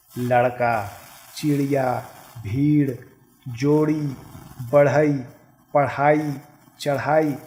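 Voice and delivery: each word slow and clear, the ड़ and ढ़ as a flapped tongue-tip r-sound; teacher sounding out "लड़का" to "चढ़ाई" with a crisp flap; careful pronunciation demo. Shown as a demonstration. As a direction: say "चिड़िया" with a clear retroflex flap ड़. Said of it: "उच्चारण पे ध्यान दीजिएगा".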